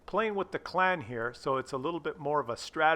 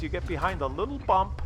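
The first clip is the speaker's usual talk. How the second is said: ordinary talk, nothing marked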